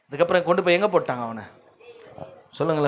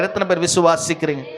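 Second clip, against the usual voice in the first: ordinary talk, nothing marked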